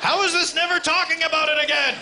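very high, loud hammed-up outcry; high-pitched mock outrage; mock distressed yell. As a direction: wail it out at the top of the voice. Acting out beaten men complaining.

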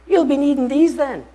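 put-on high, loud Welsh old man; old man's voice. Old man on the bench offers socks in a high, loud voice. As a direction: give him a lilting old gentleman's voice, high and loud. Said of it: "he says".

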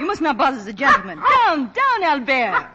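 loud, high, quick commands to a dog; scolding the dog. Acted. Woman loudly scolds her dog for pestering the gentleman. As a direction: shoo the dog off sharply, voice up high.

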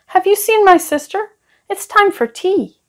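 put-on high boyish voice asking; little boy asking. A narrator's reading. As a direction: sing it up like an eager small boy. Ben asking his friend.

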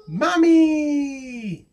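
high drawn-out cry; excited title call. Acted. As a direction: call it out high, stretching the end. Title called out in story reading.

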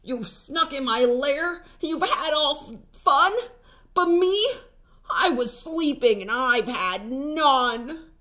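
a woken bear complaining he missed the fun; grumbling bear. A narrator's reading. Woken bear grumbles at the party guests. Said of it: "Bear growls and he grumbles".

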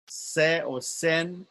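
2 "Se or sen" said very slowly, each syllable held; 3 stretch "Se or sen" out so students hear the length; 1 drawn-out syllable demo. Shown as a demonstration. 4 teacher slowly spells out "Se or sen" while marking syllable lengths.

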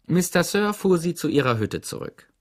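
plain reading voice

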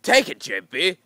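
loud taunt stretched out slowly; retold yelling. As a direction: gloat at the opponent. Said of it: "yelling at".

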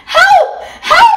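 very high, loud shout; loud cry for help. A narrator's reading. Bear stuck in the bath, shouting loudly for help. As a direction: yell for help at full volume. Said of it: "Then very loudly".

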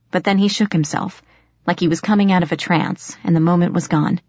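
ordinary delivery, nothing marked

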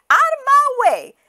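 very high, loud goose order; very high bossy goose. Acted. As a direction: shove past as the goose. Goose pushes off.

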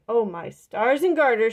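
loud startled holler. A narrator's reading. Old woman hollering at her churn. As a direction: holler it out in alarm. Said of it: "she hollered".

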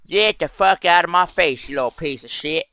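hammed-up hillbilly telling someone off; hillbilly accent. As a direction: put on a twangy hillbilly accent. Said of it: "Start talking like a fucking hillbilly".

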